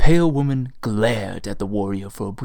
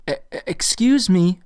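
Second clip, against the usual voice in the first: stammering; 7 semitones higher, 4 dB louder than usual. A narrator's reading. Selina stammering to get attention.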